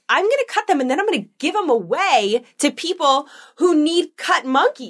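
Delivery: hammed-up threat to her son; mock threat. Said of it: "I was like".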